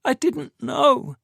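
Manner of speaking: high, slow broken denial; anguished denial. Acted. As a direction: drag it out in despair. Tamsin crying, denying.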